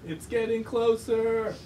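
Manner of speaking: high, stretched-out warning; acted alarm. Reader voices sailor nearing a rock, alarmed. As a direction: raise the alarm in a drawn-out cry.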